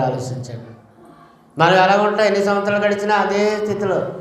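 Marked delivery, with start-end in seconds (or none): 0.0-0.7 a soft, much quieter call to think it over; hushed appeal. Acted. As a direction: drop to a near-hush on the last word. Preacher's hushed appeal to congregation.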